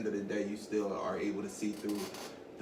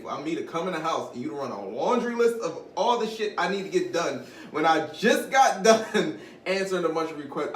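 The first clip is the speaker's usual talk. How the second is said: louder, higher exasperated rant; 6 semitones higher, 7 dB louder than usual. Real, not acted. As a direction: vent it, voice rising. Venting frustration.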